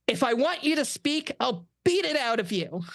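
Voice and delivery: high, menacing pirate leader; a hint of a ruthless pirate captain; pirate mistress. A narrator's reading. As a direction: threaten him like a pirate queen. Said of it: "mistress of the winter constellations".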